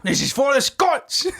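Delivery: high Scottish rallying cry; rally them like a Scottish warrior; Scottish battle cry. Pretend.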